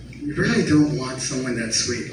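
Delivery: ordinary delivery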